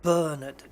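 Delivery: "Bernard" as BER-nud, loud on BER; first-syllable stress. Shown as a demonstration. Host explaining British emphasis, "Bernard" stressed as BER-nud. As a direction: punch BER in "Bernard", swallow the rest. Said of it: "emphasis on the first syllable".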